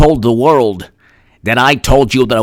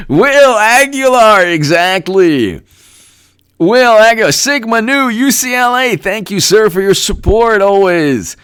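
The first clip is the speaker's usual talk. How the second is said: excited call; 10 semitones higher, 4 dB louder than usual. Acted. Calling out donor thanks.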